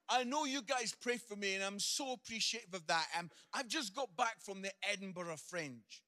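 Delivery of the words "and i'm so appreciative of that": normal talking voice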